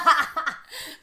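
loud burst of laughter; a big loud laugh; bright loud laugh; real laugh. Real, not acted. Laughs at own joke.

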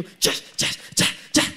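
breathy, voiceless mock agreement, slow at the end; mock whispered yes. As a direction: whisper it like a dazed yes-man. Reacts to his assigned ministry. (sí fingido en susurro, lento)

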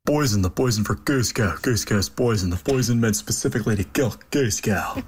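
lightly voiced Kronk impression; a hint of the Kronk voice; Emperor's New Groove impression. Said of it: "very confident in his impressions".

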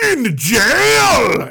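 sudden high, loud shout; mock furious yell. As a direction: blast the last word as the verdict. Manager throws brothers in jail.

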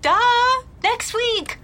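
high, loud gleeful teasing; excited announcement. Acted. Sister announces surprise visit.

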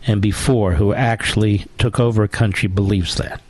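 normal talking voice

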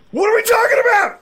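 high, loud disbelieving shout; loud cry of disbelief at his own fans; exasperated shout. Real, not acted.

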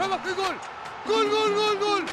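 breakneck goal commentary. Acted. (narración de gol a toda velocidad)

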